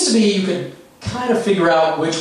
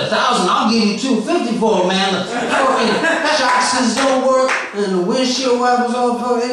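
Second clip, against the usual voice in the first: impression of rambling comic, higher; 5 semitones higher than usual. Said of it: "I'm going to do his set for you right now, verbatim".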